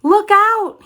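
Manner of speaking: high, loud warning shout; cry of alarm. A narrator's reading. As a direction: yell the warning. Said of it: "he cried".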